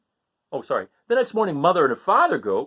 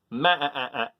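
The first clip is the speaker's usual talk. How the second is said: bleating ma-ah-ah again like a goat; one clear goat bleat. Shown as a demonstration. Reading to kids, the goat's bleat once more. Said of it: "This is".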